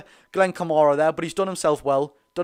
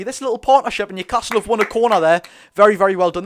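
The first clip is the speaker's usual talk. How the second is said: excited; 5 semitones higher, 7 dB louder than usual. Real, not acted. Corner won, live excited commentary.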